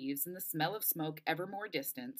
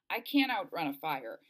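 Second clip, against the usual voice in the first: plain reading voice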